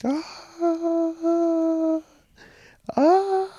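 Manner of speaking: high, drawn-out mock scream; mimicking a distant scream; imitated woman screaming. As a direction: wail it like a woman screaming in the alley, loud and high. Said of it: "like, screaming like that".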